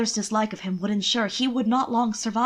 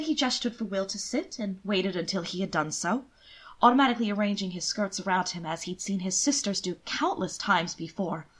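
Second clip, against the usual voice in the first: ordinary delivery, nothing marked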